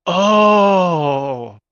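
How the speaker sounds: long, loud, drawn-out exclamation; loud realization. Acted. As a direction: let a big acted realization ring out. Character reacts to the message.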